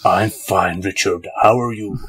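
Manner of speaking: low, deep movie-star voice. Acted. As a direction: answer like a gruff film hero. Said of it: "we're gonna be doing up with movie voices".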